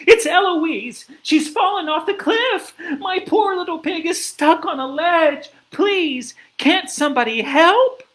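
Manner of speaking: high, loud cry for help; screaming. A narrator's reading. Missus Lark running into town over her fallen pig, screaming. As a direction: scream a desperate plea to the whole town. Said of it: "came running into the town screaming".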